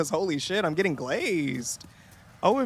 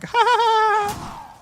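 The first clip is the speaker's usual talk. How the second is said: much higher fake coworker laugh; mock polite laugh; 8 semitones higher than usual. Coworkers fake-laugh at her penis jokes, mocked. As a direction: imitate coworkers humouring her with forced laughter. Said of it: "they're just like".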